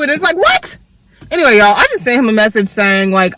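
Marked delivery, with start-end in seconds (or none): 0.4-0.8 very high, outraged "What?"; shocked what. Retold or imitated. Reacting to the breakup text, high outraged disbelief. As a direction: squeal it in disbelief.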